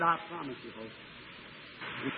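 fading to a breathless whisper on Hope; a tender vow that dies away; hushed promise. Retold or imitated. Promising dead wife in dark.

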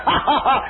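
high taunting laugh; mock laugh. The crowd laughs Jairus to scorn, high mocking laughter. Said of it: "He said".

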